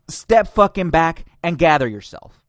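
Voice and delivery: furious sarcastic echo; angry mock repeat. Real, not acted. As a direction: spit the quote back in disgust. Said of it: "mocking repeat, angrier take".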